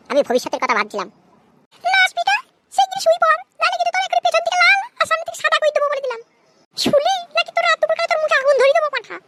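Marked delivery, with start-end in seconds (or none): 1.8-9.2 very high-pitched cartoon mother, irritated; high put-on mother voice; scolding mother. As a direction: scold the boy in a squeaky, high mum voice. Skit mother threatens boy into bed.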